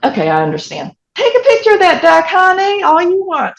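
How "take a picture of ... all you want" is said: high, loud and fast, sugary mock permission; mock indulgence. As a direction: brightly wave her off with teasing sweetness. Mock-indulgent remark about the tourist.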